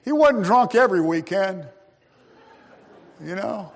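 lightly mock a fond funeral speaker; mock eulogy. Mourner praising drunk Uncle Joe, mock gushing. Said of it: "someone will get up and say".

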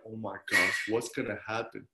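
low, quiet retold dread; hushed dismay. Preacher recalls watching the TV news, hushed dismay. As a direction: drop low and soft, imitating stunned worry.

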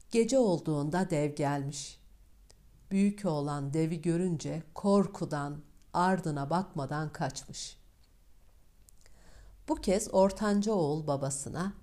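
ordinary delivery

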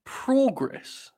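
"Progress" high and drawn out as PRAW-gress; made-up progress. Shown as a demonstration. Host inventing another way, high drawn-out "PRAW-gress". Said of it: "I've just made another way".

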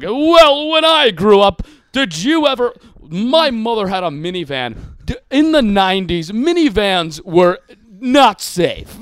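loud, mannered whitest-sounding comic; John Mulaney. Retold or imitated. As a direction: project it like a nineteen-forties actor. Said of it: "And, like, he's like".

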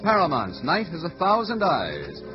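usual speaking voice

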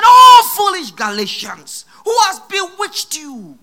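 loud, high shouted rebuke. Retold or imitated. Paul rebuking the Galatian church, full shout. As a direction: thunder at them as Paul did. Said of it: "He said".

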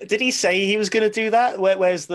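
high, loud disbelief; surprised. Real, not acted. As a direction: blurt it out in shock. Shocked at four fights claim.

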